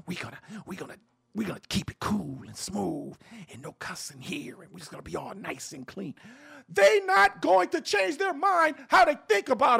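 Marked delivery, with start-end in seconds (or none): 0.1-6.1 soft, low, prim radio host voice; mock proper radio voice. Mocking the polished radio host, prim and soft. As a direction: lay on the clean, polite host tone. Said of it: "no matter if we speak proper".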